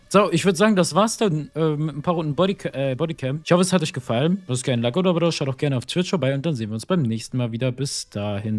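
munterer Abspann, höher und lauter; fröhlich verabschieden wie ein Moderator; YouTube-Outro. Acted. Outro des Videos. (energische Outro-Ansage)

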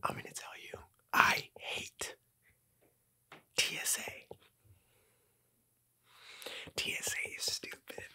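unvoiced conspiratorial whisper. Real, not acted. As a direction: whisper it like a secret. Secret confession about TSA.

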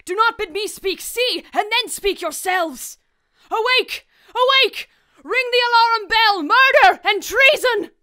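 high, loud alarm shout; frantic high cries of alarm; raising the alarm. Acted. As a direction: wake the whole castle.

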